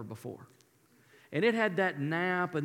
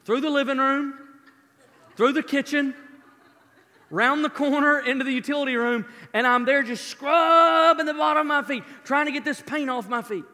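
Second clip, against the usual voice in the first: frantic dash; 7 semitones higher, 6 dB louder than usual. Retold or imitated. Child racing to hide the paint.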